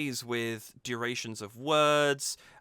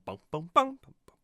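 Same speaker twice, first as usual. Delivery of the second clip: high, punchy sung bums; imitated beat; 6 semitones higher, 4 dB louder than usual. Reviewer mimics the song's strong beat, punchy high bums.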